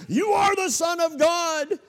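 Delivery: shrill, very high, loud cry; demons shouting. Retold or imitated. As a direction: scream it out like a demon.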